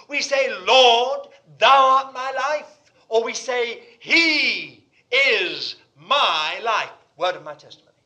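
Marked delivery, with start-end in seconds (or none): 0.6-6.9 loud, weighty proclamation of faith; bold declaration. Acted. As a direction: declare each word slowly and forcefully.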